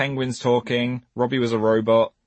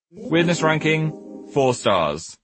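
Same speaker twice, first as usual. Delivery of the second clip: announcer; 5 semitones higher, much slower than usual. Acted. Recurring ranking announcement.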